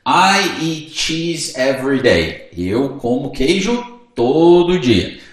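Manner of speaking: slow, clear example sentence; slow model answer. Shown as a demonstration. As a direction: read the example slowly. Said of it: "respostinha ali de exemplo". (exemplo lido bem devagar)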